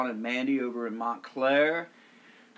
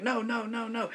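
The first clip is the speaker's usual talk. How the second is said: refusing lifeguard; 8 semitones higher than usual. Retold or imitated. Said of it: "He's like".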